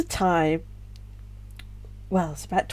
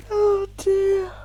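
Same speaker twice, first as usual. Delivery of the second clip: tearful high oh dear; 12 semitones higher than usual. Real, not acted. Crying, after a laugh.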